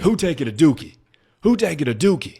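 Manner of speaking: high, loud mock demand; imitated demanding shout. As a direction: yell it like someone pounding on the door. Man banging on toilet door.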